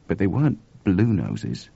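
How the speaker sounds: usual speaking voice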